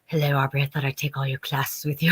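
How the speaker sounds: lightly voiced creepy low hello; a hint of a stalker's low greeting; mock creepy greeting. Friend playing Robert Englund, a touch of low creepy voice. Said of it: "And he's like".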